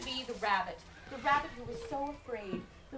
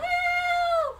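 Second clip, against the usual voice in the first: long, high, loud call for help; crying out; 11 dB louder than usual. Acted. Rabbit heard across the rainforest, long high cry. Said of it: "the rabbit who cried out".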